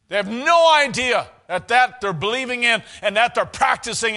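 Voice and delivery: loud, high, indignant denunciation; full-force preaching. Real, not acted. Preacher decrying a corrupt Roman world, full-force preaching. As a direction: hammer the list and cry out the verdict.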